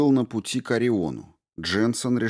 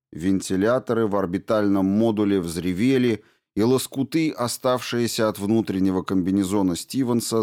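usual speaking voice

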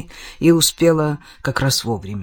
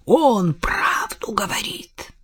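whispered, slow threat; menacing whisper; 3 semitones higher, 4 dB quieter, slower than usual. Acted. Father whispering a threat at Kelly, slow. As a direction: hiss it under your breath. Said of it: "прорычал он".

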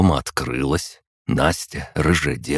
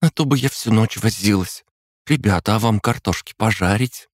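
put-on chirpy girl voice; 6 semitones higher than usual. Настя keeps chirping voice.

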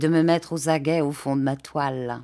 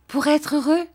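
Dora ravie; 9 semitones higher, 4 dB louder than usual. A narrator's reading.

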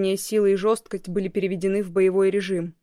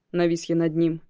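ordinary delivery, nothing marked

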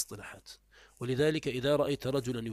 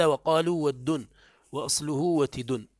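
slow, louder recital of ود and وتد; slow clear reading; 2 semitones higher, 6 dB louder, slower than usual. Shown as a demonstration. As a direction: read ود then وتد slowly. (نطق ود ووتد ببطء وبوضوح)

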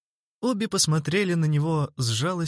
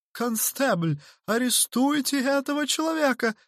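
very high, burbling plea; gurgling victim; 12 semitones higher than usual. A narrator's reading. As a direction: burble it indignantly to the constable. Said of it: "пробулькал мистер Хорспот". (булькающий высокий голос)

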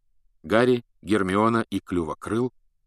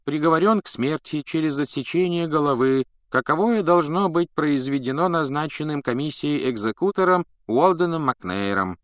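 official droning; 6 semitones higher than usual. A narrator's reading. Fudge reads the sentence.

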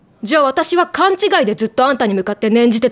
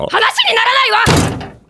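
exasperated; 11 semitones higher, slower than usual. Real, not acted. Fed up outburst.

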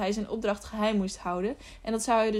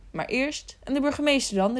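higher-pitched questioning call; mild cry of objection; 5 semitones higher than usual. A narrator's reading. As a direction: break in with a raised, urgent question. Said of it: "riepen Doawen en een andere gast".

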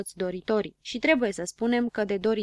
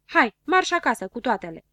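loud gruff order to clear off; shouting them out; 4 semitones higher, 7 dB louder than usual. Acted. Boy shouting at the crowd to go home, loud and rude. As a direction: bark it, shooing the crowd home. Habar n-am chases the little ones.

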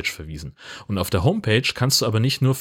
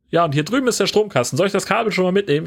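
high, fast eager helper; 10 semitones higher, faster than usual. Retold or imitated. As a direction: rattle it off high and overeager. Eager camper helper quoted.

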